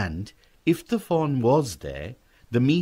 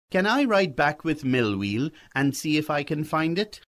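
put-on higher-pitched boy's request; eager young boy; 6 semitones higher than usual. Boy asks Pa to ride after the fawn, higher and eager.

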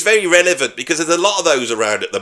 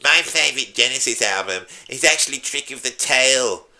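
whiny voice. Pretend. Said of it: "that stupid voice".

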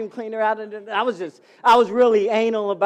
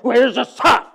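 mock dismissive retort; 6 semitones higher, 9 dB louder than usual. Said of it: "He never said".

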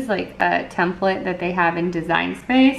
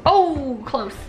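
playful; 5 semitones higher, slower than usual. Real, not acted. Playing catch with toddler.

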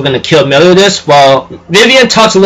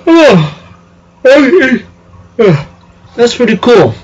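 much higher, put-on impressed voice; impressed reaction; 7 semitones higher, slower than usual. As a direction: pitch it way up, mock-amazed. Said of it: "So I'm going".

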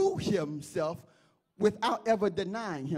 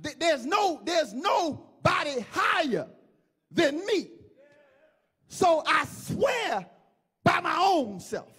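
higher, emphatic oath in God's role; preacher voicing God; 6 semitones higher than usual. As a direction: proclaim it as God, in measured bursts. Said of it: "God says".

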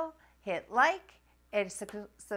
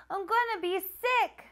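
high, queasy panicked plea; panicked cry; 10 semitones higher than usual. Acted. Nancy telling her teacher she'll be sick, panicked. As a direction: sound about to be sick. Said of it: "I cry".

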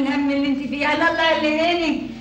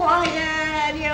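wailing lament; much slower than usual. Acted. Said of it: "بس ما تعيطيش".

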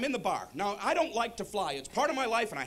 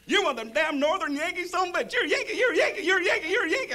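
high, loud hillbilly jeer; taunting Southerner; 9 semitones higher, 6 dB louder than usual. Acted. Goober driver heckling the Northern visitor, shrieking taunt. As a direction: chant the accusation, climbing higher and louder.

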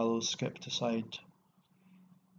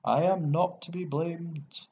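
high, drawn-out indignant protest; slow it down and raise it, refusing all blame; passionate cry; 6 semitones higher, 4 dB louder than usual. A narrator's reading. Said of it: "his tubes were hoarse with passion".

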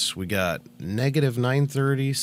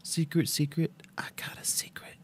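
secretive whisper; 7 semitones higher, 6 dB quieter than usual. Pretend. Teasing secret singsong.

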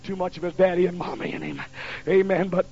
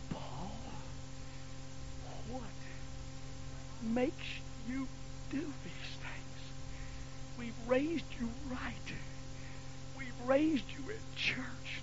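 softer, higher, slow tearful pleading; crying mother; 5 semitones higher, 17 dB quieter, slower than usual. Retold or imitated. Mother crying to her son in the bedroom, soft and tearful. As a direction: plead with a wayward son through tears. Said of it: "She was crying".

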